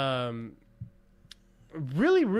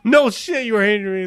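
mock incredulous retort; 7 semitones higher, 8 dB louder than usual. Man mocking her denials, loud incredulous retort.

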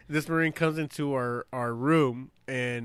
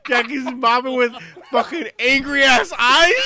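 ordinary talk, nothing marked

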